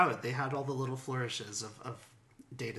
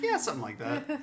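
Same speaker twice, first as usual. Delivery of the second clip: high mock quote; 13 semitones higher than usual. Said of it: "she's like".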